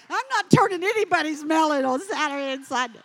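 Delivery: loud, fast punchline protest; comic emphatic refusal. Acted. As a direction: refuse loudly and quickly for laughs. Comic punchline refusing weekend melon duty.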